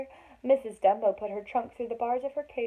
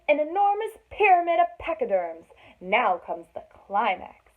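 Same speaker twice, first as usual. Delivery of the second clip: grand showman ringmaster; 3 semitones higher, 6 dB louder than usual. Acted. Ringmaster boasting of his new idea.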